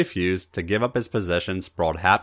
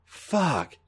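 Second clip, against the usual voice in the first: sleep roughened; 7 semitones higher, 6 dB quieter than usual. A narrator's reading. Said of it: "He settled on a sleep roughened".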